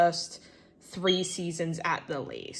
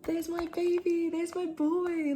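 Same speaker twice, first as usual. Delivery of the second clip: much higher cooing voice, doting on a drawing; 12 semitones higher, slower than usual. Real, not acted. Fan cooing at her drawing of Lester, much higher doting baby talk. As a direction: coo at the drawing like it's a pet. Gushing over a favourite drawing.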